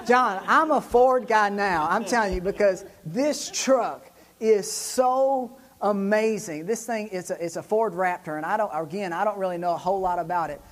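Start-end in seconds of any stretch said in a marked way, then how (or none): 3.0-6.6 THIS TRUCK IS SO AMAZING drawn out slowly; awestruck emphasis. Real, not acted. Preacher gushes to John about the truck, slow awestruck emphasis. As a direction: stretch each word, savoring SO and AMAZING. Gushing over the truck to congregation.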